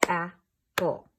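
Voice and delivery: loud and split, AP-PLE; clapped syllables. Shown as a demonstration. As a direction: beat out each syllable of "Apple" slowly and loudly. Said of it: "I'll clap out my syllables".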